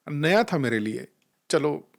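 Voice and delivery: normal talking voice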